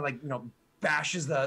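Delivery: usual speaking voice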